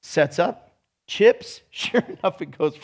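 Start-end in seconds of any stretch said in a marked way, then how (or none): none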